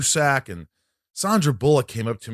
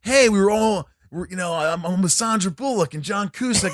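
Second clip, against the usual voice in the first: mock excited boast; 8 semitones higher than usual. Re-enacts his stupid excited remark.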